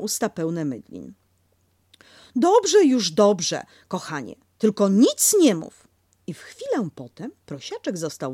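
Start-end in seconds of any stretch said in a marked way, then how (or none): 2.4-5.9 louder soothing mother; brisk hush; Kanga hushing. Acted. Kanga hushing Piglet, louder.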